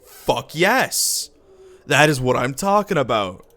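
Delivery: loud, delighted whoop; triumphant cheer. Real, not acted. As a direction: celebrate the new power. Reward gained, celebrates.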